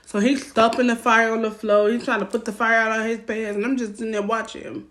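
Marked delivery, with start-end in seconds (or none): none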